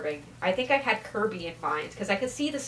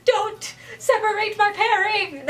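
higher, slower, half-laughing fake cry; mock wail; 9 semitones higher, slower than usual. Young fan begging not to split her favourite pairing, mock wail. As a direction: whine like a heartbroken young fan. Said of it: "I was like".

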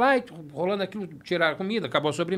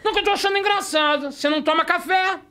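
mock mom scolding; 10 semitones higher, 5 dB louder than usual.

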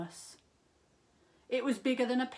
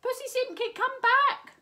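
much higher, louder call; crying out; 11 dB louder than usual. A narrator's reading. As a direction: call him back across the park. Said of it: "cried Siamese Ching".

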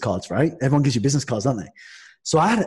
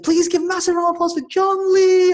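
much higher, announcer-style build-up; hyped host intro; 3 dB louder than usual. Retold or imitated. Host introducing the speaker to the crowd, hyped announcer. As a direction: sell the guest like a showman.

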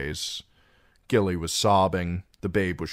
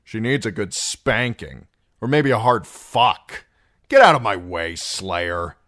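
hostile sneer; 4 semitones higher, 8 dB louder than usual. A narrator's reading. Darion crude and hostile to Sam.

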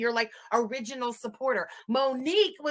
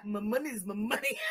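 mimicking pimp drawl; 4 semitones lower, 8 dB quieter than usual. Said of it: "Why did he say it like that".

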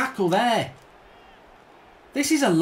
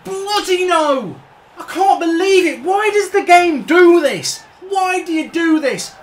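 furious rant; 7 semitones higher, 5 dB louder than usual. Real, not acted. Gamer yelling at the game after a goal, furious. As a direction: yell at the game for betraying you.

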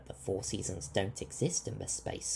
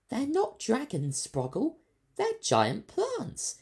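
much higher, slow know-it-all correction; smartly; 6 dB louder, slower than usual. A narrator's reading. Doctor correcting the scared creature, smartly. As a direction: correct him knowingly. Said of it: "she said smartly".

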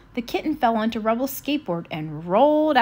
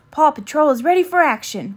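eager report; 4 semitones higher, 6 dB louder than usual. Acted. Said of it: "reported Chase, sitting at attention".

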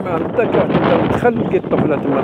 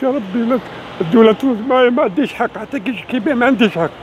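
angry crying; 5 semitones higher than usual. Real, not acted. Pleading to God in despair.

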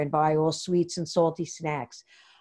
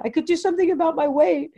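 blurted out; 9 semitones higher than usual. Retold or imitated. To her therapist, blurting out a sudden high confession. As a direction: blurt it out as it bursts free. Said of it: "And I blurted out".

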